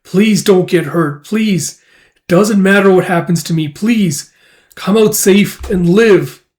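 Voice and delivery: desperate pleading. Acted. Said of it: "I was like".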